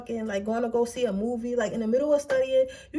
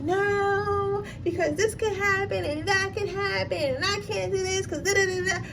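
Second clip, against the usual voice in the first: high, whiny mock excuses, near tears; mock the worrier listing what could go wrong; whiny anxious self; 7 semitones higher than usual. Reader whining excuses to the inner child, high and whiny.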